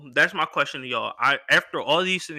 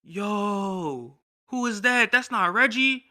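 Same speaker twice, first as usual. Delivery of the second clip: mock surprised crowd, pitched higher; partygoers' surprise; 6 semitones higher, much slower than usual. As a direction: guests stare and ask who he is, surprised. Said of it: "Everyone starts looking at me like".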